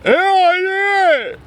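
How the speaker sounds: high, loud triumphant yell of the champ; victorious movie-hero shout; Rocky's cry. Retold or imitated. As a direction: throw your arms up and roar it like Rocky at the top. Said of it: "when he did the".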